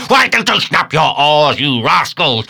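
high, loud burst at the crew; scolding shout. A narrator's reading. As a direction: berate the rowers loudly.

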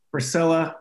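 ordinary talk, nothing marked

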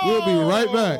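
hammed-up commercial-break sign-off; mock announcer. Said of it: "that's a commercial break".

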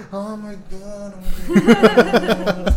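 high, slow gasping oh my god building to a laugh; gasping disbelief. Retold or imitated. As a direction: gasp it out in stunned relief. Gasps at being rescued.

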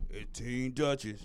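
low, slow deep-voiced name. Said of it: "Then I said".

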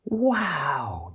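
high, wondering wow; child's awed wow; amazed wow. A narrator's reading. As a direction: gasp it out in awe. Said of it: "Joy says".

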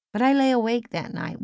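ordinary delivery

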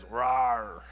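low playful mock roar; a low pretend lion growl. Daddy lion calls mama lion, low mock roar. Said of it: "daddy lion says".

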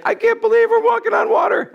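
high, loud shout of giddy disbelief; excited high-pitched exclamation; amazed Peter. Acted. Acting Peter amazed on the water.

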